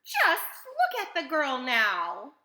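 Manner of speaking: loud, high, slow outcry; upset mother's loud outcry; cried out. A narrator's reading. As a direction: cry it out, stretching the words. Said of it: "cried Missus Beauregard".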